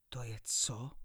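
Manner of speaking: startled, breathless whisper; frightened whisper. Acted. Gentleman alarmed by the chemicals, whispering. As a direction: gasp the question in alarm. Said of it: "vyděsil se pán".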